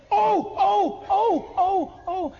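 high, repeated yelps of mock agony; acted pain. Framer after hammering his thumb, hammed-up pain. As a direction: yelp as if the thumb is throbbing.

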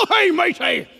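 high, hearty pirate call; hammed-up pirate greeting; joking pirate. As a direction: hail a shipmate in mock pirate voice. Pirate joke on Skull Chapel.

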